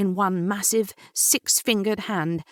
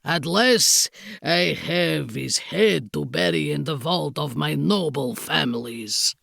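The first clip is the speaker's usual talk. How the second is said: snarling Count; 5 semitones lower than usual. A narrator's reading. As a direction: snarl as a foreign villain. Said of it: "he snarled".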